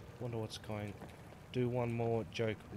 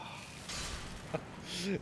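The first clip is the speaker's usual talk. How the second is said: breathy laugh; 9 semitones higher, 4 dB quieter than usual. Real, not acted. Reacting to the joke.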